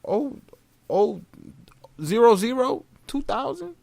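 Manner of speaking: teasing question. Retold or imitated. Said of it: "I used to be like that".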